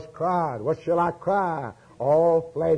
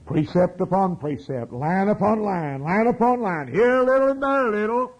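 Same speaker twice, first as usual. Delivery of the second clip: climbing higher and louder, racing at the end; building preaching; 5 semitones higher, 3 dB louder than usual. Acted. Preacher piling up scripture, rising to a fast loud peak.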